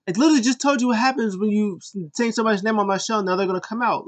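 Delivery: mock exasperated host voice; hyped Kevin Owens. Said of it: "It's like".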